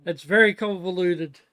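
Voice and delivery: loud, casual call to the next room. Real, not acted. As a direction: project it to a housemate off mic. Calling out to housemate off mic.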